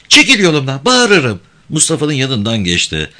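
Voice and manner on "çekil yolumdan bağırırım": put-on high, louder girl's warning; defiant village girl. A narrator's reading. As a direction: warn him off in a high girl's voice. Girl threatens to scream.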